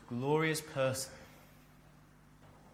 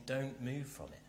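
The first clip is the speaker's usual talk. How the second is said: hushed, earnest urging; quiet plea; 11 dB quieter than usual. Acted. Paul to believers moving from good news, hushed plea. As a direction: softly implore them not to leave. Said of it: "He's saying".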